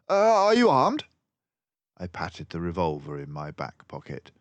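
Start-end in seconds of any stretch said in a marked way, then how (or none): 0.1-1.0 high, loud, brisk detective voice; a clever mouse sleuth, pleased with himself; Basil the mouse detective. A narrator's reading. Narrator voicing Basil to Dawson, high and loud.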